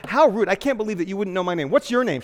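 loud, high scandalized interruption; huffy, demanding mock scold; indignant woman. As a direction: cut him off like an offended lady.

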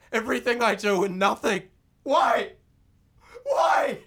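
high, loud, out-of-control screaming; screaming rage. Acted. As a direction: scream at her in blind rage. Violent rage, murders her.

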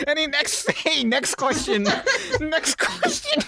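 helpless high-pitched laughing speech; laughing through words. Real, not acted. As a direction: try to move on through a fit of laughter. Trying to move on while laughing.

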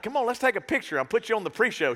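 hyped-up friendly offer; excited invite. Retold or imitated. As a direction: pump her up for a photo. His excited retold reply.

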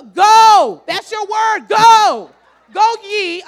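shouted command. Pretend. God shouting at the stalling believer, loud command. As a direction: shout it across the church.

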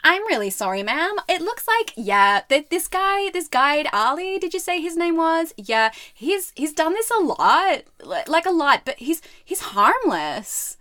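high, swooping Texan receptionist; bad Texan accent. Receptionist excusing the guide to caller, high Texan accent.

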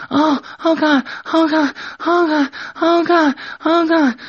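very high, loud acted screams; high put-on screaming. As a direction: squeal it out, the same pitch each time. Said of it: "she starts screaming".

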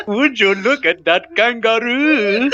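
put-on high, slow, laughing Australian accent; drawn-out mock Aussie wonder at a kangaroo. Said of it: "That's two accents".

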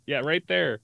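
ordinary talk

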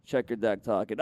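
deep deadpan instruction; David Blaine. Acted. Street magician sets up the card reveal, low impression. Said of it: "give Ollie the voice of".

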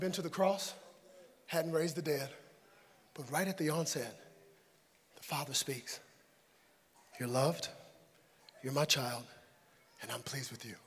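very soft, low, tender sermon voice; hushed preaching. Real, not acted. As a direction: let the declaration fall almost to a murmur.

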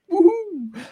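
high whooping cheer; excited cheer. Real, not acted. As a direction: whoop for joy. Cheering about the cinema.

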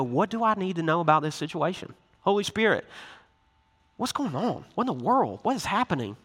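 soft, hushed questions; softly wondering what is going on; quiet prayerful asking. Retold or imitated. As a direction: ask under your breath, searching. Pausing to ask the Spirit.

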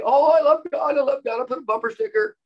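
high, loud mocking sing-song; cheesy mock piety. Preacher mocking believers who gush about loving God, high and cheesy. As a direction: parody a gushing believer. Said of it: "too cheesy for people to say".

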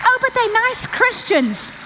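high, slow mocking of a trusting parent; sing-song scoffing excuse; mock naive parent.

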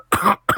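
sharp throat clear. Acted. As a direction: cough to get attention. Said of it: "a cough".

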